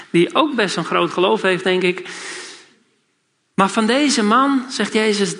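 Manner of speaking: normal talking voice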